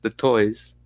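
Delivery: put-on lightly Russian-accented child; broken English with a hint of Russian accent. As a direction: explain it in a mild Russian accent. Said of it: "you like the Russian accent".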